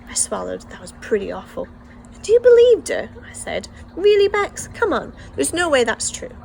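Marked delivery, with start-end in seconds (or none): 2.2-3.1 high, loud disbelieving question; can't believe she'd fall for it; incredulous. Acted. Brother to tearful sister about the bully, incredulous. 4.0-6.4 high, loud disbelieving question; can't believe she'd fall for it; incredulous. Acted. Brother to tearful sister about the bully, incredulous. Indignant reassurance.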